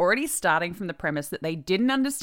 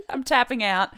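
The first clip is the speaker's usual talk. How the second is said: laughing; 7 semitones higher than usual. Real, not acted. Host laughs at stubborn person.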